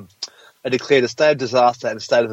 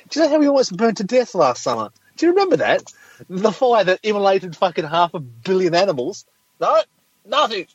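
high mocking sarcasm; sarcastic; 7 semitones higher than usual. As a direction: scoff at climate deniers. Sarcastic denier rant.

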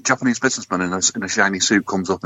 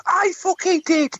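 high, drawn-out indignant outburst; Kat on a box; slower than usual. Acted. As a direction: pipe up in a high, stretched voice. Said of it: "Kat stands on his box and he says".

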